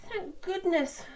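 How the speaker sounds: high, relieved little engine; relieved small engine. Acted. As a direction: breathe out thanks in a high voice.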